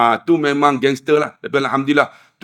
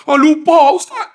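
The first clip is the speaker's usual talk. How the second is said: very high startled exclamation; imitated high, flustered cry; flustered realisation; 12 semitones higher, 5 dB louder than usual. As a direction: blurt out that it slipped your mind. Acts man suddenly remembering.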